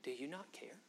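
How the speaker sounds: a soft, wounded whisper; whispered hurt. Retold or imitated. Martha whispers her hurt to Jesus. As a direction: breathe it out as if hurt. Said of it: "She said".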